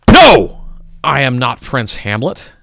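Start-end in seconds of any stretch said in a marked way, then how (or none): 0.1-0.6 much higher, loud "No!"; sharp outcry. Acted. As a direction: fling out the denial. Sudden denial in recited poem.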